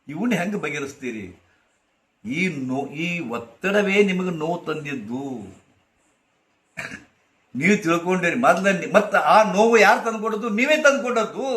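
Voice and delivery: ordinary talk, nothing marked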